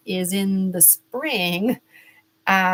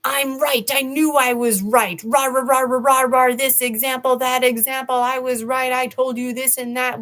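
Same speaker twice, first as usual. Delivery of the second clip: higher, louder smug crowing; mock gloating; 5 semitones higher, 3 dB louder than usual. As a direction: lord it over everyone, rah rah rah. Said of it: "we tend to waste time celebrating".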